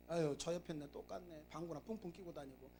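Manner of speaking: low, very soft grumbling; mock spousal grumble. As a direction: grumble under your breath, unimpressed. Bored spouse grumbling at home. (심드렁하게 낮은 소리로 투덜대기)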